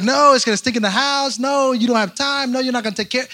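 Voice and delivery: objecting wife. Retold or imitated. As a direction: lightly mimic a wife saying no. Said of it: "she was always like".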